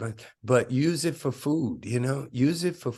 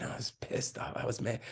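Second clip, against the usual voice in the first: hushed anger; 10 dB quieter than usual. Real, not acted. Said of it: "unvoiced whisper".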